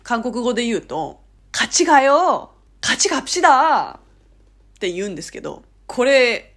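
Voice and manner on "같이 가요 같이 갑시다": strong emphatic nudge. Shown as a demonstration. Inviting someone along in Korean, insistently coaxing. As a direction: press the invitation, stretching the last syllable. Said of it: "念押しのニュアンス強めじゃないですか".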